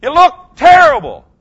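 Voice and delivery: high, loud, slow coach's yell at the team. Retold or imitated. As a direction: bark at the players in a timeout. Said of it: "and you go".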